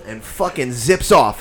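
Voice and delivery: ordinary delivery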